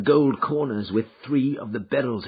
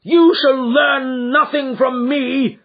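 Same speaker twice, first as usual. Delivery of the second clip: slow, high, loud defiant outburst; passionate defiance; 12 semitones higher, 7 dB louder, slower than usual. Acted. Son defying his father with sudden passion. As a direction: throw it back with passion. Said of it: "said he with a passion".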